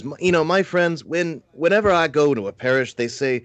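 high-pitched imitation of a New York priest; New York priest accent. Priest telling a conference of his welcome, mock New York accent. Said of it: "New York accent, he says".

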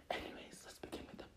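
voiceless, drained start; barely any breath left to speak; soft unvoiced whisper running out of air; breathless whisper. Real, not acted.

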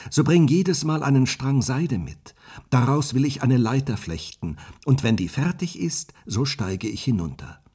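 a maiden agreeing to flee, bright and quick; young Rapunzel. Acted.

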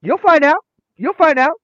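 high, loud teasing refrain; teasing secret. Retold or imitated. Teacher to student after texting her mom, teasing.

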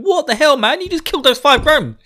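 loud, high-pitched disbelief; shocked exclamation. Acted. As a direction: exclaim in hammed-up amazement. Hurin exclaims to Rand, acted.

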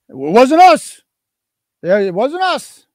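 high put-on voice of town workers; mock denial. Town answering a complaint about the mailbox, mock denial.

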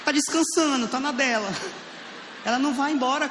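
high, mocking; high lazy-poop voice. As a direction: voice the poop lounging about. Mock-voicing the lazy poop. (voz aguda zombando do cocô preguiçoso)

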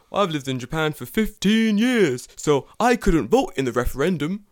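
high, loud British caricature; mocking UK accent. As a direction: imitate the pub man's British accent. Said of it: "your mocking, like, U K accent".

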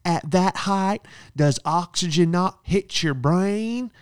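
high, slow, sneering put-on voice; mocking rude lady. Rude guest mocking his height, high sneering voice. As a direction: roast him like a snide stranger. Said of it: "and she goes".

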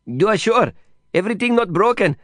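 put-on high, worried Indian-accented questioning; anxious Indian friend. Worried friend asks again.

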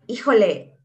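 ordinary talk, nothing marked

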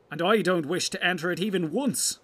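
high, loud hobbit protest; piping-up objection. Acted. Hobbit blurts his refusal.

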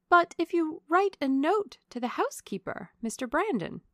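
high, loud, pleading eagerness; eager. A narrator's reading. Young lady urging the colonel to write instead, eager. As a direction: burst in with a hopeful suggestion. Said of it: "said Marianne eagerly".

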